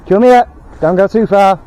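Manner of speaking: loud, high call to a dog. Real, not acted. Owner calls his wandering dog, loud and high. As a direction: call the dog back across the park.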